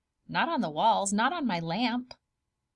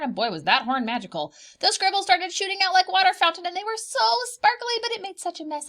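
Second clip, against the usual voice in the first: fast, higher and louder, tumbling out; child blurting the whole story to mom; rushed excited explaining; 5 semitones higher, 4 dB louder than usual. Acted. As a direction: babble it all out before mom can speak.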